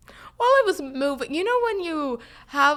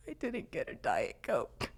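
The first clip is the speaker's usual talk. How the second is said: lower, softer small voice; mock pitiful; 7 semitones lower, 10 dB quieter than usual. Telling roommates the tragedy, mock pitiful and soft. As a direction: whimper it, half-joking. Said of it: "And it was just like".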